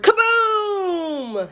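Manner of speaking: hollered kaboom. A narrator's reading. May suddenly hollering her volcano noise. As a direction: blast it out like a volcano. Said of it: "she hollered the word".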